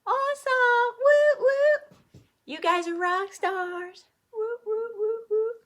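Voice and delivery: high, loud cheering; high excited praise. Real, not acted. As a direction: whoop like a cheerleader. Cheering students on.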